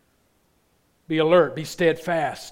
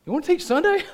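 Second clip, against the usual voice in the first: quoting a friend, high; 5 dB louder, faster than usual. Retold or imitated. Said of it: "He goes".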